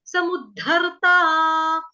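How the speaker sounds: समुद्धरता slow, dh stressed; careful pronunciation. Shown as a demonstration. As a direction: stretch out समुद्धरता. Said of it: "समुद्र नहीं बोलना है".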